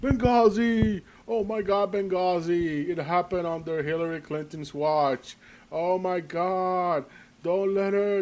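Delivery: high, drawn-out panicky wail; hammed-up shrieky alarm without full volume; mock hysterical screaming. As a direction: parody an outraged mob baying after its target. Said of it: "they're going to scream".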